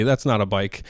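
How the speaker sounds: usual speaking voice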